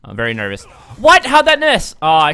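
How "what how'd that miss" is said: high, loud disbelieving yell; incredulous outburst. Real, not acted. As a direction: cry out at an impossible miss. Shot misses in the game.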